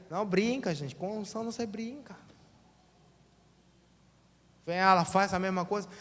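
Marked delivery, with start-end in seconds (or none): none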